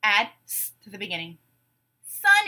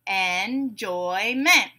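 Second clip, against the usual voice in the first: "Enjoyment" very slowly, en-joy-ment; syllable by syllable; much slower than usual. Shown as a demonstration. Teacher breaking a word into syllables, beat by beat. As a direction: stretch "Enjoyment" into three separate beats. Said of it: "we'll break it into syllables".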